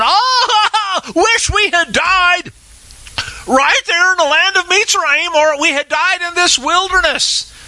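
high, laughing, hammed-up whine; mock the complainers with a high whiny wail; mock whining congregation. The preacher mocks the congregation grumbling to Moshe, high and whiny. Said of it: "The whole congregation said to Moshe and Aaron".

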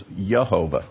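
Yehovah opening on a Y sound; Old English pronunciation. Shown as a demonstration. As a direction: start Yehovah with Y, not J. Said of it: "the way this is pronounced was".

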